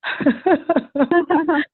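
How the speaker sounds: a real, high laugh at the teasing; amused laugh. Real, not acted. Laughing when the friend calls her very spoiled.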